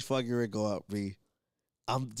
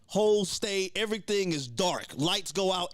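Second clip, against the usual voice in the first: ordinary talk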